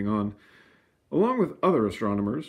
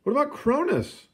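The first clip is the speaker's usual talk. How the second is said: eager suggestion; 3 dB louder than usual. A narrator's reading. Public suggesting names.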